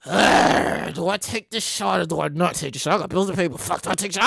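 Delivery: low, soft muttering to himself; hushed, torn self-talk; quiet inner debate. Retold or imitated. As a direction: mutter the dilemma under your breath. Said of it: "And I'm like".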